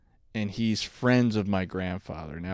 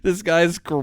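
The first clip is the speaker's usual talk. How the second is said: ordinary talk, nothing marked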